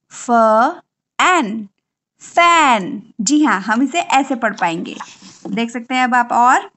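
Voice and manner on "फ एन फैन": फ, एन, then a loud फैन; sounding out. Shown as a demonstration. Teacher asking parents to blend फैन, loud. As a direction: separate फ and एन, then land on फैन. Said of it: "आप कैसे पढ़ेंगे".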